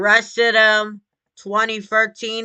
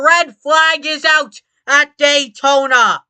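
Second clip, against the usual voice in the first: motorsport commentator; 6 semitones higher, 5 dB louder than usual. Acted. Live race play-by-play after crash.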